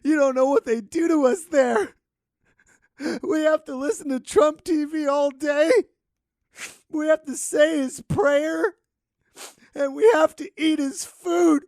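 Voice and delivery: high, loud, hammed-up crying plea; mock desperate wail. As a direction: wail like an escaped captive. Pretend American refugee begging Canada.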